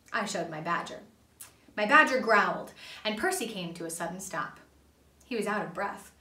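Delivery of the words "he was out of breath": usual speaking voice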